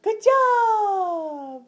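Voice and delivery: very loud, high, long-held cheer; jubilant praise. Real, not acted. Praising toddler for arms up.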